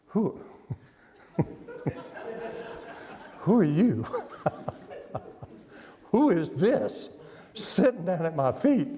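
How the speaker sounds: half-whispered, cracking up; man whispering to the woman at his feet, laughing; whispered, breaking into laughter. Shown as a demonstration. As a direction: try to whisper but laugh through it. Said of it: "with a whisper".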